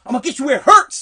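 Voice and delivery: loud imitated threat, sticking on M; stuttering caddy master. Caddy master threatening an eleven-year-old, stuttering then shouting. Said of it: "he stuttered".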